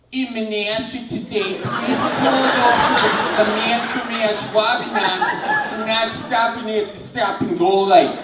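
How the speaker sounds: put-on high-pitched Wisconsin accent anchor read. Student anchor trying to impress professor, thick Wisconsin accent. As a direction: read the news in broad Wisconsin vowels. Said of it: "I had a Wisconsin accent".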